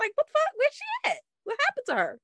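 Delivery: can't believe they dropped her; baffled high-pitched what the fuck; exasperated disbelief. Real, not acted. Baffled at missing character.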